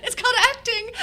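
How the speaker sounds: high, loud comeback through laughter; retold retort, squealed with laughter; laughing retort. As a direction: cry out the punchline, cracking up. Punchline retort to director, retold laughing.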